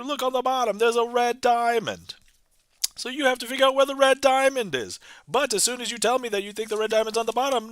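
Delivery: put-on high, sustained old-time announcer pitch; hammed-up vintage radio patter, pitched well above normal talk; 1920s radio announcer. Magician answers imagined accusation of cheating, old-time radio announcer voice. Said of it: "am I like a radio announcer".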